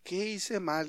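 very soft, to himself; hushed self-questioning; puzzled inner thought. Retold or imitated. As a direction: wonder privately what went wrong. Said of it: "yo empezaba a pensar".

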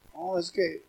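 imitating a soft timid mumble; a hint of a shy man mumbling to the pastor; meek mumble. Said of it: "lo hacía así".

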